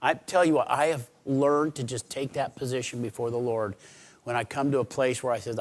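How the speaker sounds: usual speaking voice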